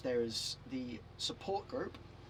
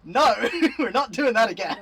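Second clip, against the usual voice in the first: laughing loud refusal; 8 semitones higher, 14 dB louder than usual. Real, not acted. Refusing to go back to chef work.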